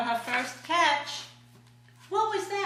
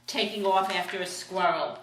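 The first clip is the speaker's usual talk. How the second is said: plain reading voice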